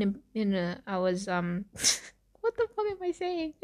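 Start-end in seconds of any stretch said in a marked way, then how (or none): 1.7-3.5 high, laughing disbelief at yourself; helpless high giggle through the words. Real, not acted. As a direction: crack up mid-sentence, voice pitched up. Cracking up at own slip.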